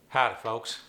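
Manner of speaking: folksy Appalachian greeting; Appalachian accent. Acted. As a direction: say hello in an East Tennessee hill accent. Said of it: "my buddy Earl".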